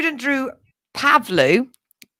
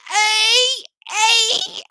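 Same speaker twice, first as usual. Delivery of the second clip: mock cheering shout; 10 semitones higher than usual. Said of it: "he thought".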